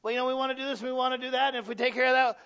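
mock eager excuser. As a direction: mimic someone rushing to justify themselves. Imitating someone who jabs at you.

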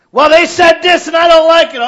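loud, high indignant complaint; offended complaint. Acted. Offended member complaining, loud and high. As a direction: protest what they said.

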